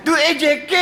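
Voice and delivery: high laughing shout of the punchline. Real, not acted. Preacher laughing at the AJK story. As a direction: burst out laughing as you name him.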